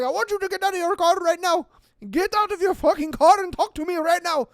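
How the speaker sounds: put-on fast, high, loud demand in a Middle Eastern accent; hammed-up furious driver giving orders; mock raging. Raging driver ordering them out of the truck, mock fury. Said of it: "starts raging at us".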